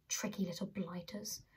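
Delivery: soft whispered aside; whispered grumble. Acted. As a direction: mutter it under your breath. Butler grumbling to himself.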